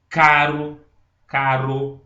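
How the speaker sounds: "caro" slowly, with a light tapped R; single-R demo. Shown as a demonstration. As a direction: stretch "caro", tapping the R once. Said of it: "um som de um R".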